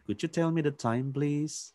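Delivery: please lifted high like asking a favour; polite question, please rising high at the end. Shown as a demonstration. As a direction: raise please as if asking for something. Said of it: "Please-nya agak naik, rising".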